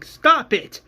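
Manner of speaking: high, loud command; acted scream. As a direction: yell to make him stop. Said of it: "Rick is screaming".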